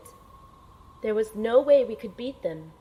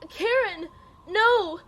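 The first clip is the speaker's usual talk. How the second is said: much higher and louder, crying out; anguished cry; 11 semitones higher, 6 dB louder, slower than usual. Acted. The agent cries out as Karen is taken hostage. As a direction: scream her name in alarm.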